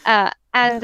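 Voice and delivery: usual speaking voice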